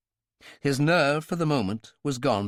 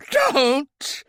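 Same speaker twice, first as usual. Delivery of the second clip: high, loud desperate cry; hoarse cry; 7 dB louder than usual. Acted. Royale crying out at Talbot, high and loud. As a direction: cry out in panic. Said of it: "A hoarse cry".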